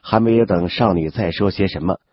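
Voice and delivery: plain reading voice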